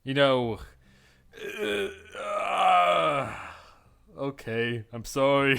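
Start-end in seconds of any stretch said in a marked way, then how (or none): 1.6-3.3 startled stammering yelp; flustered yelp. Real, not acted. Reacting to the flirty line, a startled yelp. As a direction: blurt out a flustered cry.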